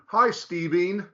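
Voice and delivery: STEVEEN with a long, drawn-out EEN ending; mispronounced name. Shown as a demonstration. Teacher testing a student with his mangled name, deliberate mispronunciation of STEVEEN. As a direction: greet with STEVEEN, ending stretched to rhyme with seen. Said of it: "if I called you".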